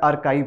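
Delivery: "आर्काइव" as "ar-KYVE", hard k; correct pronunciation. Shown as a demonstration. Teacher giving the correct pronunciation, "आर्काइव" said with a k sound. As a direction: say "आर्काइव" with k and an eye vowel. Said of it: "इसका correct pronunciation होता है".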